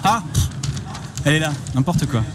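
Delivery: usual speaking voice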